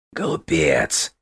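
low hissed insult. Acted. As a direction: spit it like a dragon. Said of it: "прошипел дракон".

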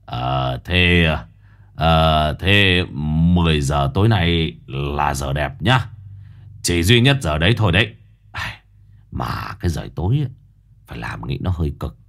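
put-on low, hesitant wheedling old man; old Quý fawning. A narrator's reading. Quý wheedling money from the mother, low. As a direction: hem and haw while angling for cash, low. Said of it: "lão quay sang cười".

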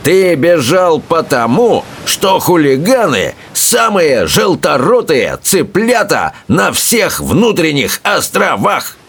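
high, slow taunting shout; angry Bertha. A narrator's reading. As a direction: taunt him furiously. Bertha keeps yelling.

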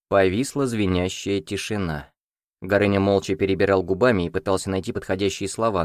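ordinary delivery

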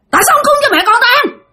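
high, rapid-fire shriek; angry screaming ghost. Retold or imitated. Ghost mother turning on the sleeper, shrieking fast and high. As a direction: snap into a fast, scolding yell. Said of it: "Nạt lên, la làng lên các bạn".

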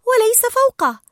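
high-pitched, urgent character voice; Dory, earnest warning. Acted. Dory warning Marlin of danger ahead, high and urgent. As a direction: raise the pitch well up and warn.

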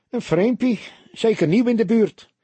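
high, loud barman's question; nosy barman. A narrator's reading. Barman eyeing an unfamiliar customer. (nieuwsgierige kroegbaas, hoog en luid)

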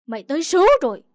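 enraged outburst; furious snarl. A narrator's reading. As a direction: explode at her in rage. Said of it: "Bố tôi rít lên".

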